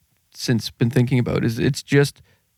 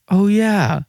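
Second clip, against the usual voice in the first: higher, drawn-in sudden recognition; re-enacted realization; 8 semitones higher than usual. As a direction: relive it dawning on you. Voices the moment of realization.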